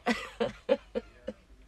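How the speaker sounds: breathy, helpless giggling fit; can't stop laughing mid-tutorial; real fit of giggles. Real, not acted. Crafter cracking up at her own joke, helpless giggles. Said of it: "j'ai un fou rire".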